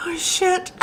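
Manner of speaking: ordinary talk, nothing marked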